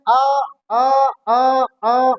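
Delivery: आ stretched long and repeated; drawn-out vowel demo. Shown as a demonstration. Teacher shows students आ keeps flowing from the mouth. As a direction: let आ ring on and on. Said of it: "आ ही बोलता रहूं तो मुंह से आ".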